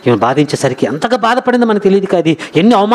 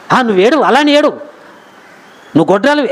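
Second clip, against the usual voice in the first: imitating a dismissive husband, pitched up; mock scolding; 6 semitones higher than usual. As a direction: toss out a mock rebuke. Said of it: "అని తిట్టాడా". (కసురుకునే భర్త అనుకరణ)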